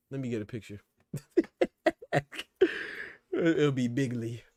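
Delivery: a playful, half-hearted Trump bragging; Trump impression. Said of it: "a Trump impersonation".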